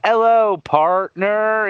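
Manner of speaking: put-on slow cowboy-style American accent send-up; mocked American accent. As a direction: imitate foreigners spoofing slow American talk. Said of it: "when people make fun of Americans".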